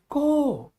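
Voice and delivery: co drawn out slowly, no P or S; correct pronunciation. Shown as a demonstration. Teacher shows students the right way, co without final consonants. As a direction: end co on a long open O. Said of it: "This is co".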